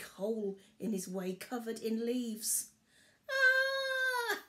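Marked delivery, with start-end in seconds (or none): 3.3-4.4 high cry of a cub falling. A narrator's reading. Barley falls into the pit, high cry. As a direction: yelp as the ground gives way. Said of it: "Barley cried as he fell".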